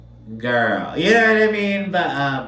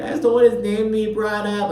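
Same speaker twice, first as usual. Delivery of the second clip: mock crying; 9 semitones higher than usual. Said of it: "Like, full on crying".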